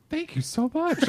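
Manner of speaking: high-pitched, gushing mock gratitude; gasp, then thank them as if flattered. Insulted person thinking it a compliment, gushing high thanks. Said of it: "people be like".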